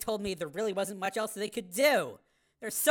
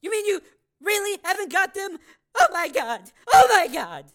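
shocked; 10 semitones higher, 8 dB louder than usual. Acted. Mother realizing kids are lost.

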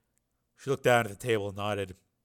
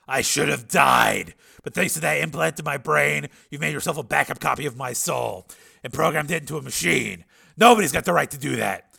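raised, higher, pressing argument; Steele, agitated; 4 semitones higher, 8 dB louder than usual. A narrator's reading. Patient imagines his mind in another body, loud and agitated. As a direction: build to a loud indignant question.

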